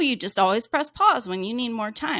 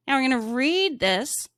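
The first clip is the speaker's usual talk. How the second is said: normal talking voice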